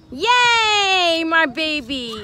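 high, loud yay for the little one; cheering. Real, not acted. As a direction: cheer him on with delight. Cheering her toddler.

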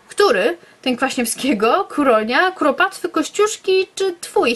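playful rising taunt; teasing character voice. Acted.

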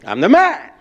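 big forceful boast; loud demonstration. Shown as a demonstration. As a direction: declare it loud, as a model to copy. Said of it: "he doesn't get loud as me".

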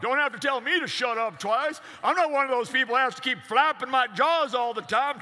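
high, loud blustering cartoon rooster; Foghorn Leghorn impression. Cartoon rooster babbling at the cat, blustering. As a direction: rattle on like a windbag rooster. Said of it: "Yeah, Foghorn Leghorn, that guy".

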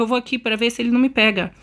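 normal talking voice

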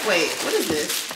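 usual speaking voice

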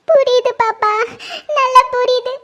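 crying as she gives in; tearful. Acted. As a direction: answer meekly through tears. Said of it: "இப்ப எதுக்கு அழுதுட்டு இருக்க".

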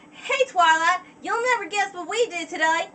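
high, eager filly with a put-on country accent; Apple Bloom accent. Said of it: "the best accent I can do for Apple Bloom".

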